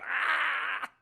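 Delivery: high drawn-out "ah" yell; mock falling scream. Imitating Rhodey flying into the sky, high mock scream. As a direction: imitate someone hurtling away screaming.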